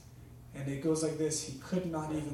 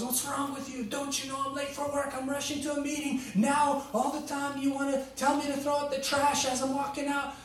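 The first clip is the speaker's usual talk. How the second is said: much higher, irritated mock rant at his wife; angry husband; 10 semitones higher than usual. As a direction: play the rushed, annoyed husband complaining. Husband snaps at wife over trash.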